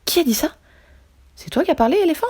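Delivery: high, alarmed calls of surprise; startled cry. Acted. Hippo, startled by a talking melon, cries out high. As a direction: jump at the hidden voice, pitch shooting up. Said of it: "Hippo crie".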